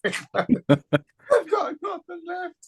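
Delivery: high, slow half-laughing wail of despair; gutted wail. Real, not acted. Fan tells his co-host he is gutted, wailing high and slow. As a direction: stretch it out like a broken man, laughing at himself. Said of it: "I'm gutted, lad".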